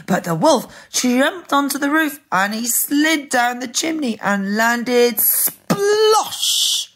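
high, animated build to the splash; lively children's story peak; dramatic storytelling. A narrator's reading. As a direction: narrate the climax excitedly. Dramatic narration of climax.

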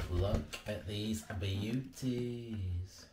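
normal talking voice

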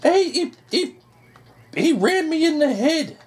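shrill-pitched yelp of outrage, way up high; startled high yelp at a teammate; high squeal. Real, not acted. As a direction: squeak it out in disbelief. Rammed by own teammate, squealing.

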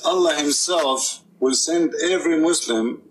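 ordinary talk, nothing marked

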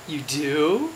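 ordinary delivery

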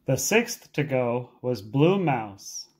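ordinary delivery

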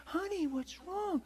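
high, slow, soft sweet wife voice; wife gently asking her crying husband. Retold or imitated. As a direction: coo it sweetly like a caring wife. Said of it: "She's all sweet".

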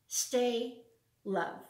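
ordinary delivery

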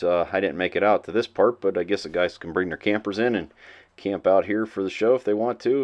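ordinary talk, nothing marked

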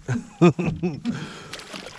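high happy laugh; delighted laugh. Real, not acted. Big fish just landed.

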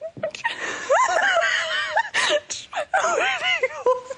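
shrieking uncontrollable laughter; high squealing laughing fit; hysterical laugh. Real, not acted. Weather girl breaks down again.

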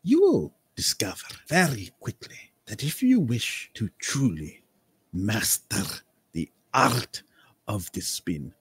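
theatrical, savoring each word like a mentor of the spin; lordly, mysterious eccentric voice; eccentric nobleman. Acted. GM voicing eccentric Lord Cambria.

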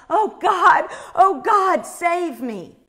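high, loud plea easing on the last words; imitated desperate cry. Imitating a panicked woman at the teller's feet, crying out. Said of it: "this woman is screaming".

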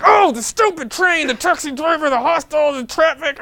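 high, slow, loud mock rant; mad rant. A furious traveller blaming everyone, loud and high mock rant. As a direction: list every culprit in a hammed-up fury. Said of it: "and you get so mad".

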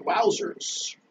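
soft, breathy mock Inspector Gadget exclamation; a lightly voiced Inspector Gadget wowzers. Imitating the cartoon inspector for viewers, soft mock wowzers. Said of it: "Like Inspector Gadget would say".

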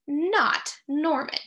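ordinary delivery

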